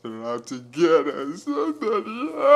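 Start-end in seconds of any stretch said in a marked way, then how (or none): 1.4-2.4 mock panic. Mock panic about poisoning.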